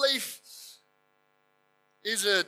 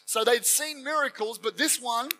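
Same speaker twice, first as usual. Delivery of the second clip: ordinary delivery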